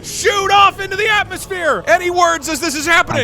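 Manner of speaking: high, shouted disaster narration; game master's scene setting. Acted. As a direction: call out the catastrophe at full drama.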